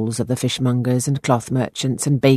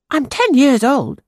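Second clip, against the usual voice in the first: put-on higher, earnest young boy voice; 11 semitones higher, 4 dB louder than usual. A narrator's reading. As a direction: play the anxious ten-year-old boy. Boy Peter voiced in story.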